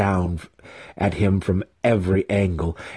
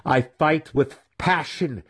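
higher, slow, heated retort; snapped; 8 semitones higher, slower than usual. A narrator's reading. Weapon master snapping back at Matron. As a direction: bite back with pride. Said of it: "the weapon master snapped".

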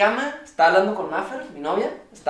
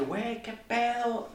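stunned disbelief; 7 semitones higher, 9 dB quieter, much slower than usual. Retold or imitated. Retelling reaction to neighbors evacuating.

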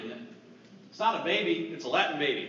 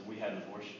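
very low, hushed admission; retold grief, voice dropped; somber confession; 11 semitones lower, 10 dB quieter, faster than usual. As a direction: let it out quietly and heavily. Retold confession before crying.

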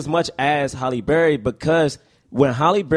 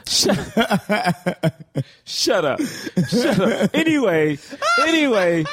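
laughing while talking; 6 semitones higher, slower than usual. Real, not acted. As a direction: giggle through the words.

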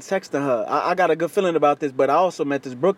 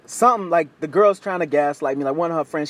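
normal talking voice

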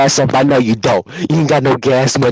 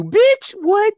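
much higher, incredulous squeal; outraged what. Retold or imitated. Son outraged at dad's answer, high squeal. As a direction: squeak out the disbelief.

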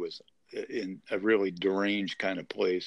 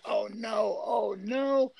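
high anguished cry of oh no, rising to a wail; despairing cry; 11 semitones higher, much slower than usual. Retold or imitated. Hiker cut off from 911 cries out in despair. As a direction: wail it out as the call drops again. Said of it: "Oh, and I said".

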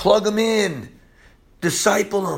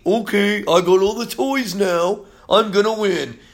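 mock boasting; 4 semitones higher than usual. Preacher mimicking a worldly toy collector, mock boasting. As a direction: draw out the smug brag, poking fun at it.

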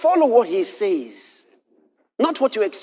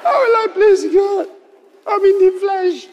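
loud, very high-pitched wailing plea; hammed-up tearful lament, pitched way up; mock crying; 4 dB louder than usual. Preacher imitates himself despairing of pleasing God, mock wailing. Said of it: "Then maybe I start crying".

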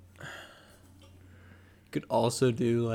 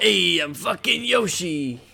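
slow, louder, raised pitch; hammed-up Yoshi bravado; mock Yoshi voice; 5 semitones higher, 5 dB louder than usual. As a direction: announce yourself as a brash cartoon dino. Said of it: "they hired you just to do that".

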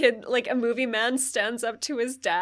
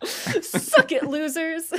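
higher, laughing taunt at the losers; mock taunt; 6 semitones higher, much slower than usual. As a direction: tease like a gleeful winner, laughing. Continuing the mock victory cheer.

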